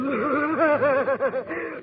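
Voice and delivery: high, wailing ghost laughter; eerie ghostly laugh. Acted. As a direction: laugh like a moaning spook.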